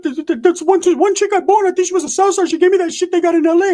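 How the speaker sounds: very high, excited falsetto character; high squeaky homeboy voice; high-voiced homeboy. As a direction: squeal it out in a tiny cartoon voice.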